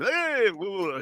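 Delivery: high-pitched fake whooping; mock excitement. Mocking someone who tries to stage it, fake excitement. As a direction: put on a staged, over-eager holler. Mimics a staged funny moment.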